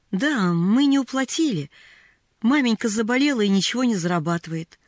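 ordinary delivery, nothing marked

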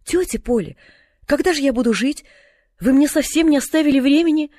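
alarmed protest. A narrator's reading. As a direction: protest in dismay to your aunt. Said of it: "испуганно крикнула Полианна". (испуганно, высоко и громче)

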